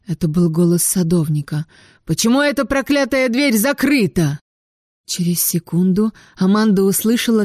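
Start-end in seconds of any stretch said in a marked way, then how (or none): 2.0-4.4 медленно, другим голосом; рявкнуть в ярости; гневный окрик. A narrator's reading. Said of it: "гневный вопль". (садовник гневно кричит из-за двери)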